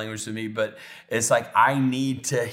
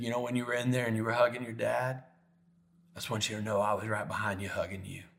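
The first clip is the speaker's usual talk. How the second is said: soft, quick-flowing comforting words; hushed, gentle voice of comfort; tender reassurance; 7 dB quieter, faster than usual. Retold or imitated. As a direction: reassure quietly, as God to a hurting son. Retold God's comfort to his heart.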